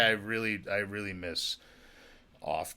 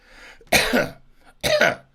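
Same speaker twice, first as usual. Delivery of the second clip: loud cough; 11 dB louder than usual. Real, not acted. Coughing fit.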